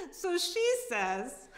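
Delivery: slow, high, breaking into laughter; can't get it out for laughing; cracking up mid-sentence. Acted. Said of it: "reading Sharon's tirade".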